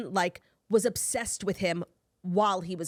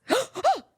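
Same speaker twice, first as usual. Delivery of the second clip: surprised gasp; 14 semitones higher, 4 dB louder than usual. Retold or imitated. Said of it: "went like this".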